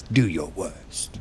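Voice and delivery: slow, dragged-out movie-villain taunt; lightly voiced movie tough guy; movie villain line. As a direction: drawl it out like a menacing film hero. Quoting a Henry Cavill movie line.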